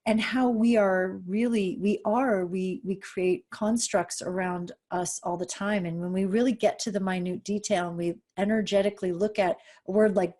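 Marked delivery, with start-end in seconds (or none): none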